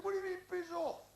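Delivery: loud, high parade-ground shout; mock military bellow; guardsman's challenge. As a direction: fire it back like a drill order.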